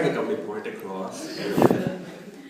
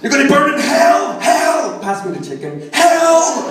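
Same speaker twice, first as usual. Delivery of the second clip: hammed-up damnation shout, much higher and louder; furious family yell; 15 dB louder than usual. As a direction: rant hellfire, then toss off the chicken request. Family yelling at Christmas dinner.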